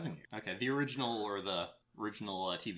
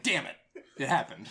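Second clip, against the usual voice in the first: laughing mock curse; 6 semitones higher, 6 dB louder than usual. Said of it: "I'm like".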